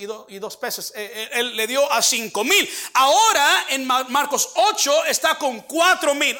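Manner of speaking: emphatic sermon delivery; preaching. Acted. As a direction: build the point to the congregation.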